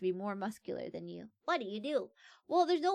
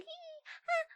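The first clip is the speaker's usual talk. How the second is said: very high squeaky hee-ha while fleeing; silly high-pitched running noise; squeaky panicked yelp; 3 dB quieter than usual. Pretend. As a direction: yelp like a cartoon escaping. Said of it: "I'd be like".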